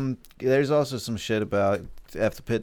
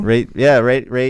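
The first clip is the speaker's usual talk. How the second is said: loud; 4 semitones higher, 11 dB louder than usual. Real, not acted. Hyping listeners to rate.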